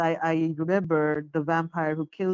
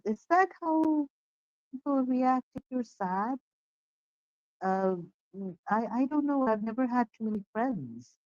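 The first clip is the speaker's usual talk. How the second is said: hurt naive plea; 6 semitones higher than usual. Acted. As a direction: ask like a lonely child. Nora hurt and confused.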